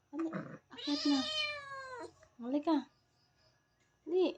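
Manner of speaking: high coaxing call. Real, not acted. Coaxing pet to climb up.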